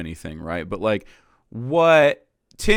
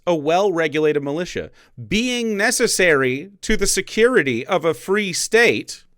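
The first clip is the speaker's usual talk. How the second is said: reading aloud the amendment; 5 semitones higher, 5 dB louder than usual. Shown as a demonstration. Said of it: "The Second Amendment reads".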